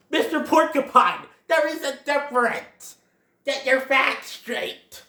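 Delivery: high, loud mock scolding; indignant correction. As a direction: tell him off emphatically, word by word.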